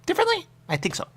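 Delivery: squeaky high mocking question; mock high squeak. Mocking a friend's odd way of saying it, squeaky high. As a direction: pipe it up high like a cartoon.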